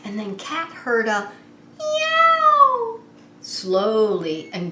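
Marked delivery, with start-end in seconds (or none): 1.8-3.0 very high, loud kitten mew; kitten meow. Acted. Reading to children, kitten calls Cat from below. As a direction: call out like a kitten from the tree.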